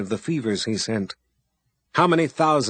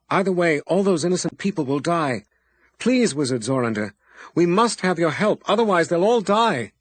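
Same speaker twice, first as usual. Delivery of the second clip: higher, louder desperate begging; frantic pleading; 6 semitones higher, 4 dB louder than usual. A narrator's reading. Abby pleads with the wizard for her people, frantically. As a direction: beg him as if lives hang on it.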